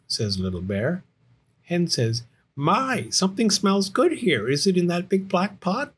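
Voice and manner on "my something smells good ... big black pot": high, chirpy storybook hen; curious Hen. Acted. Hen sniffing Little Bear's soup pot, high and curious. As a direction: play an interested hen, pitched up.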